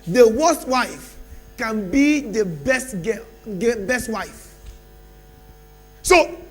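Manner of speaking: loud, rising sermon bursts with long pauses; preaching at full force. Acted. Preacher promising the congregation change, loud and rising. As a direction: drive the promise home to the church.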